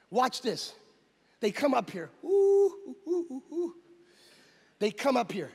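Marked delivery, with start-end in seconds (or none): 2.2-3.8 high-pitched, fading oohs; high ooh sounds. Retold or imitated. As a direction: imitate a string of hooting oohs. Mimics sound as they come up.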